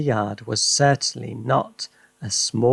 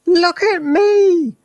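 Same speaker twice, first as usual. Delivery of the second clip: put-on high, loud old woman's cry; triumphant Grandma, high and loud; Grandma shouting; 7 dB louder, slower than usual. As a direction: crow it down from the rooftop at Mary. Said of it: "Grandma shouted from the rooftop".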